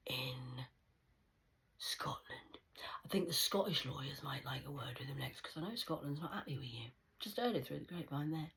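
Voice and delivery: soft hushed whisper; whispered taunt. Acted. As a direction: murmur the jabs like a secret.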